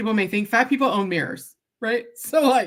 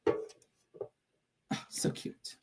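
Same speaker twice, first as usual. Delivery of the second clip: breathy whispered aside, much softer; 15 dB quieter, much slower than usual. Real, not acted. Vlogger reacting to a sweet comment, soft whisper. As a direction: whisper it under your breath, charmed.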